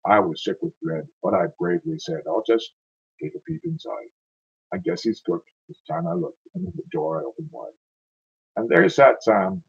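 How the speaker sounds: dramatic verse storytelling with drawn-out lines; poem recitation. Acted. Reciting a frozen-North ballad to listeners, poem recitation. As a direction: recite the ballad with a swing, leaning on the beats.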